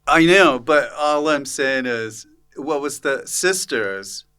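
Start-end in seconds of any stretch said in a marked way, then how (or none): none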